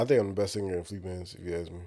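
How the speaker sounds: usual speaking voice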